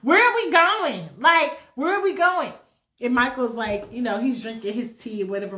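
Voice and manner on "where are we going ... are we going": high, loud, brassy demanding; sassy woman voice pestering; demanding diva. Acted. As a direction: press him, loud and impatient. Horny woman pestering Michael.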